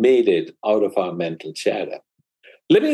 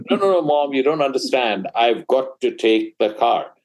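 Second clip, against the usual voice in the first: put-on higher, pushy teenager voice, slowing on the last line; insistent teen son; 4 semitones higher than usual. Son insisting to his mother he must take the car, higher and pushy. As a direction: act the teen who won't take no for an answer.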